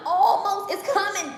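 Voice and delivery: laughing it out high. Real, not acted. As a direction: chuckle through the words. Laughing at own stomach.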